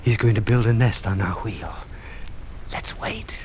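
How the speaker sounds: half-whispered, holding back hope; hushed. Acted. Teacher to children watching the stork, hushed and hopeful. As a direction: keep it hushed, barely daring to say it. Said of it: "no need to whisper anymore".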